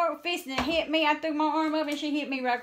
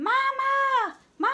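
high, drawn-out holler for help; 4 dB louder, slower than usual. Retold or imitated. Scared child alone in the car, high hollering. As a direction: re-enact calling out for Mama. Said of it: "I was hollering".

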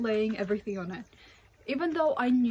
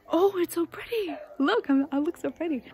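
delighted gush; 7 semitones higher than usual. Real, not acted. Admiring herself on camera.

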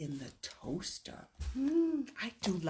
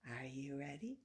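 much softer and lower, near a whisper; hushed ask; 4 semitones lower, 14 dB quieter than usual. Pretend. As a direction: pull it down to a secret hush. Loud then hushed repeat.

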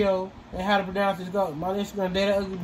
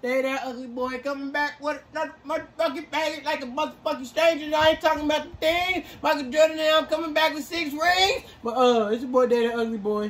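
hyped host intro, higher; youtube intro; 7 semitones higher than usual. Acted. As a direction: greet the channel like a hype host.

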